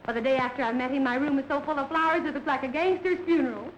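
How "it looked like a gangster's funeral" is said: ordinary delivery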